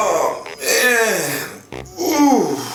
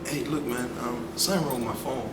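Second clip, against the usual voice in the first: lower, soft awkward voice; low nerdy mumble; 6 semitones lower, 12 dB quieter than usual. Acted. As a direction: mumble like a shy nerd. Deep quiet nerdy guy.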